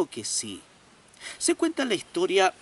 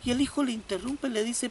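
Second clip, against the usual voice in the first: ordinary talk, nothing marked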